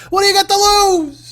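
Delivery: high, cheesy pitchman squeal; mock pitchman. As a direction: sell it like a sleazy ad.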